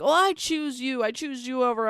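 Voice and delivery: hammed-up lovestruck boyfriend; mock romantic declaration. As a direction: swoon through it, making fun of him.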